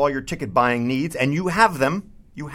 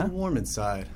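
mellow low guest; 6 semitones lower, 7 dB quieter than usual. Acted. Guest greeting the smooth host, a hushed mellow low murmur. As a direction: speak low and hushed like a sleepy mellow guy.

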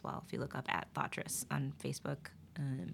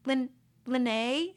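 hesitant flight attendant; 8 semitones higher, 6 dB louder than usual. Retold or imitated. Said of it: "She was like".

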